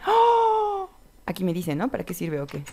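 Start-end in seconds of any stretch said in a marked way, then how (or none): 0.1-0.9 long, high "oh" of wonder; playful high-pitched gasp; high amazed gasp. Pretend. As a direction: gasp as if awestruck. Gasp at a discovery.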